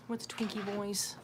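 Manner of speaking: usual speaking voice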